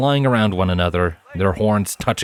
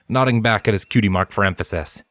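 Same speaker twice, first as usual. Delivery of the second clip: ordinary delivery, nothing marked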